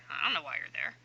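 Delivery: quick unvoiced whisper, joking to herself; whispered aside. Real, not acted. Teacher whispering a joke to her students about Carlos. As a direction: drop to a breathy joking whisper.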